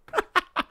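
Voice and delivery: short high-pitched laugh. Real, not acted. Streamer after his Glasgow Goths joke, high laugh. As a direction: laugh briefly and high at your own joke.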